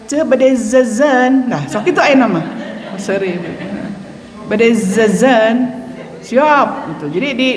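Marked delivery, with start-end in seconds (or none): 0.1-1.5 slow "Ce, bade zazan" with buzzing z. Shown as a demonstration. Teacher showing students how to practise z at the shop. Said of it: "mau jajan bilang". 4.4-5.8 slow rising "Bade zazan" with buzzing z. Shown as a demonstration. Teacher repeating the shop phrase as a drill. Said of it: "mau jajan bilang".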